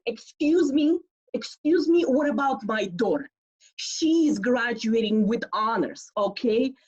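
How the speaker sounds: high, loud insistent mother; loud interruption. Retold or imitated. Mom interrupting the principal's speech, loud and insistent. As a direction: cut in loudly, demanding.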